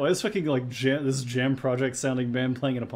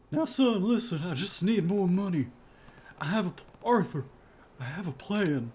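higher, pleading dad voice; father voice; 6 semitones higher than usual. Acted. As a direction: coax the son, a bit higher. Voicing father character.

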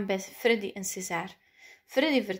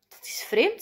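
puzzled surprise, pitched up; higher, wondering remark; surprised; 6 semitones higher than usual. A narrator's reading. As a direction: voice lifts in astonishment.

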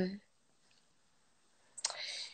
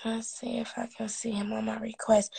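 whisper; 2 semitones lower, 8 dB quieter than usual. Real, not acted. Whispers to herself.

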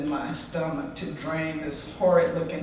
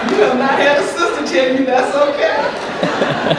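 much higher and louder, laughing through it; laughing hard; 9 semitones higher, 11 dB louder than usual. Real, not acted. To the audience, howling with laughter at the butt joke. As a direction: shriek with laughter as you land it.

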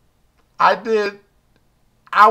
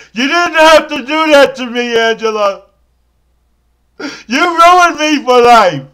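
mock wailing lament; 5 semitones higher, 6 dB louder than usual. Crying, ruined.

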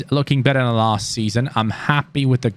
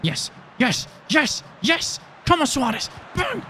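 high, rising yeses urging the striker; will the ball into the net; cheering at the screen; excited cheering; 12 semitones higher, 4 dB quieter than usual. Real, not acted. Chance building in live match.

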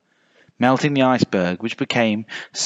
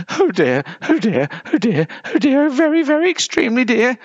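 high, mounting worry; high-pitched panicky bear; fretting polar bear; 12 semitones higher than usual. A narrator's reading. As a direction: fret higher and higher. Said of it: "she said to herself".

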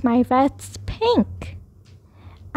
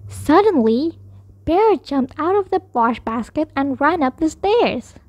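plain reading voice

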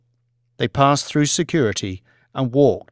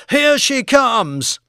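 high, drawn-out live call; excited reporter; 11 semitones higher, 4 dB louder, slower than usual. Acted. Reporter sights the falling craft, excited high call. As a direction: call it out as it comes down.

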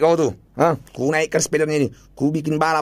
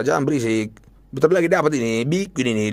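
ordinary delivery

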